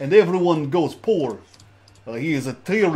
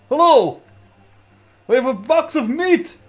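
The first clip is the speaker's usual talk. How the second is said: calling out; 8 semitones higher, 5 dB louder than usual. Real, not acted. Greeting Luma from afar.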